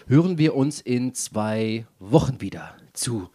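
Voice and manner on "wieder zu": spooky whisper. Pretend. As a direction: whisper it like a ghost story. Teasing the Halloween special.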